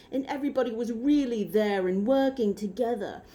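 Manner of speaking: lightly imitated emphatic British accent; British accent with emphasis. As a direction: mimic an earnest, emphatic British speaker. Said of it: "being really emphatic about talking".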